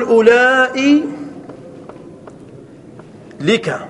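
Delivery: "أولئي" drawn out as ulaaa'ii, long pause, then "لك"; forbidden long form. Shown as a demonstration. Teacher showing students a forbidden form, slow and split. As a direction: stretch "أولئي" with its hamza and split off "لك". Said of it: "فلا يجوز أن تقول".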